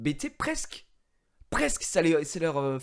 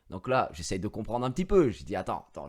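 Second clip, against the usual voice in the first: normal talking voice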